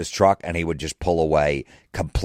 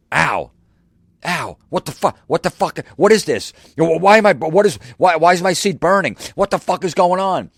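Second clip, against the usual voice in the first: high, frantic yelps, building louder; panicked yelling; 11 semitones higher, 6 dB louder than usual. Retold or imitated. Boss to prankster employee as his seat burns, high panicked yelling. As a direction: yell in mounting alarm as if sitting on fire. Boss's seat suddenly burning.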